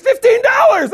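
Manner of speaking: very high, loud, gleeful announcement; elated shout. Retold or imitated. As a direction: holler the great news. Retells cheap ticket news, elated.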